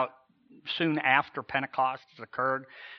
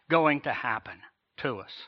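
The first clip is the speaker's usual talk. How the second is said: usual speaking voice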